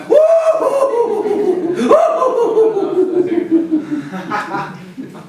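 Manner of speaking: put-on high delighted child laughing; happy child laugh. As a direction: laugh with relief and joy. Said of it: "He also began to laugh".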